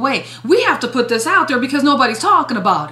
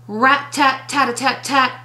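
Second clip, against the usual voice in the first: tapping noise. Shown as a demonstration. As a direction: tap it out like little slaps. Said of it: "on the hand like".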